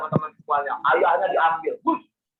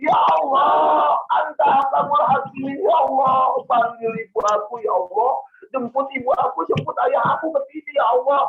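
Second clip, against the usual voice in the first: put-on higher, pleading child's cry; 6 semitones higher than usual. Pious child begs God for his parents, crying out. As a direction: call out desperately to God. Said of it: "langsung teriak bilang begini nih".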